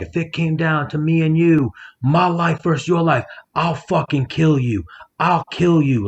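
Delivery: high-pitched mock yell of a threat; retold scream. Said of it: "He was screaming".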